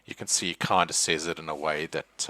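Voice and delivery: normal talking voice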